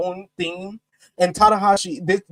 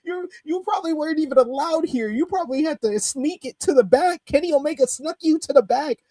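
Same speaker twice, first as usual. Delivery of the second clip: incredulous; 11 semitones higher than usual. Retold or imitated.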